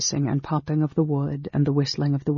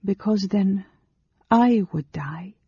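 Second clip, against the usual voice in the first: higher, earnest character voice; higher, heartfelt plea; Amberol, pleading; 6 semitones higher than usual. Acted. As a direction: plead earnestly in a raised pitch, slowing at the end.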